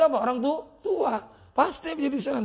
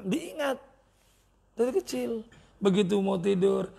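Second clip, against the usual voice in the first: ordinary talk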